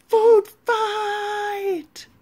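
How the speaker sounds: high, loud excited yell. Acted. A kid starting the food fight, loud high yell. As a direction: shout it across the lunchroom. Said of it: "Somebody yelled".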